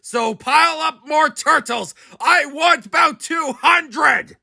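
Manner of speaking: high, loud royal order; demanding king. A narrator's reading. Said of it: "his royal voice thundered".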